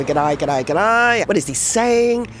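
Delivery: lightly mimicked Australian greeting; mock Australian accent. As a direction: echo the strange greeting, baffled. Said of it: "when they go".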